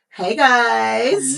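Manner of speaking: high, loud sing-song greeting; high hyped intro. Acted. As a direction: gush the welcome up high.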